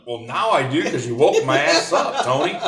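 mock-irritated reply; retold exasperated retort.

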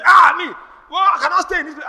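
high, loud mock wail of complaint; mock protesting shout. Preacher mimicking a woman refusing to stay, loud shout. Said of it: "women who say".

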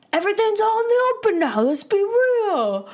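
high-pitched mock yell; Pauly angry. Recapping a castmate's blow-up in the house, mock angry yell. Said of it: "the angriest you ever see him get".

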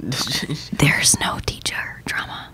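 slow hushed denial; giggly prank whisper. Pretend. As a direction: whisper it back.

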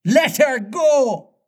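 very high, drawn-out captain's cry; high pirate exclamation, slow and incredulous; outraged cry. A narrator's reading. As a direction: cry it back in disbelief, stretched out. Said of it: "cried Hook".

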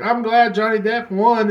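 high, slow, sing-song mock of a gloating fan. Mocking what everybody says.

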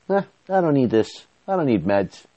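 high, offhand brush-off; retold dismissive refusal. Man quoting his manic self refusing meds, dismissive. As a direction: mimic a cocky self waving it away, starting high.

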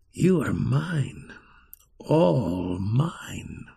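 slow, whispered menace; evil whisper. Acted. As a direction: hiss a possessive claim. Enchanter's evil words.

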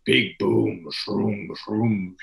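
slow, booming giant's voice; big resonant boom, stretched slowly; Treebeard. Acted. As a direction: boom it out with long, drawn vowels. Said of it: "the booming voice".

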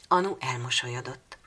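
ordinary delivery

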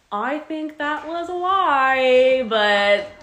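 high, very slow, drawn-out accusation; teasing call-out. Retold or imitated. Woman calling out a stranger's fake accent, teasing. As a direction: stretch it out loudly, needling him.

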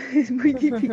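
chuckling at how hard it is; laughing. Real, not acted. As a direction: laugh it out while admitting it.

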